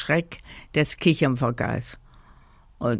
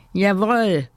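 higher, eager assent; emphatic agreement; 5 semitones higher, 4 dB louder than usual. A narrator's reading. Woolly sheep backs the elephant, eager agreement. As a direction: chime in firmly, pitched up. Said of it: "stimmte Flocki das weiße Wollschaf zu".

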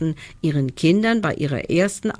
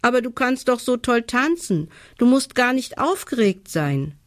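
put-on high-pitched child character in mild disbelief; ungläubig; 8 semitones higher than usual. A narrator's reading. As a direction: protest lightly, voice raised in pitch. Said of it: "fragt sie ungläubig". (leicht ungläubig, mit hoher Kinderstimme)

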